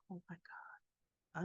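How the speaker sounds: breathy whispered disbelief, barely voiced; stunned whisper. Jack whispers to himself after Billy defies him. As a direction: whisper it like you can't believe what you heard.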